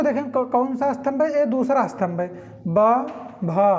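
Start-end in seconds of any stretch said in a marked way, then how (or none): 2.7-3.7 ब short, then भा slow with breathy release; slow contrast of ब and breathy भा; ba then bha. Shown as a demonstration. Teacher showing aspiration, slow ब then भा. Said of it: "ब में कम लगा, भा में ज़्यादा".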